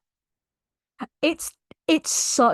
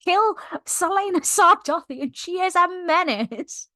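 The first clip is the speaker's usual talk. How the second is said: higher-pitched, heated order to kill her; furious demand; 7 semitones higher than usual. Retold or imitated. Captain telling the room to kill her, heated and higher. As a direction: demand her death in a fit of rage. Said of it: "Rolf turns to the others in the room and's like".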